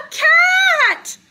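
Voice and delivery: high, loud and amazed; squealed storybook reveal; astonished reveal. A narrator's reading. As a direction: cry it out as a big surprise. Reveals cat inside the dog.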